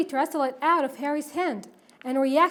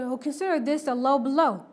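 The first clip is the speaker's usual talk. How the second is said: plain reading voice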